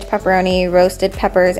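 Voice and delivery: usual speaking voice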